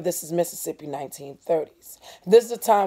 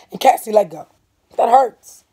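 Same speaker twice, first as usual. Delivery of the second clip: high, loud complaint; a brother hurt by his sister's grip; annoyed; 5 semitones higher, 13 dB louder than usual. Acted. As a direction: snap at her to let go. Stacy snapping.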